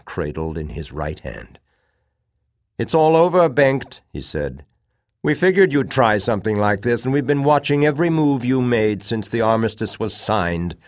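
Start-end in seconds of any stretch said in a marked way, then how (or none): 2.8-4.0 loud, high declaration of victory; triumphant confrontation. A narrator's reading. Enemy colonel confronts the cornered mutineer. 5.2-10.8 raised, emphatic voice; confident confrontation. A narrator's reading. Confident victor confronts the mutineer.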